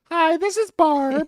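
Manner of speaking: very high, slow, cheery caller; sing-song falsetto phone greeting; exaggerated chirpy lady on the phone; Barb. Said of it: "Yeah, Barb".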